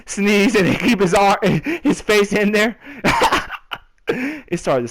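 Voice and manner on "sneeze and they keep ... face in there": laughing through the words, higher and louder; can't hold back the giggles. Real, not acted. Laughing at sneeze image.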